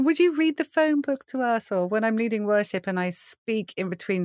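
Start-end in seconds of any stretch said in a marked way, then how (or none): 0.0-1.7 high-pitched mock request; playful impression of fans asking; imitating admirers. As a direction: pitch it up like an eager fan pleading. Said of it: "but people say that".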